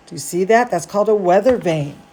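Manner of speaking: ordinary delivery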